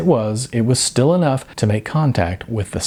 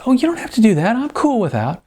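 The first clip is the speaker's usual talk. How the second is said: much higher, breezy dude voice; a high, easygoing surfer-dude voice; high-voiced Sean; 12 semitones higher, faster than usual. A narrator's reading. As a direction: play the laid-back dude.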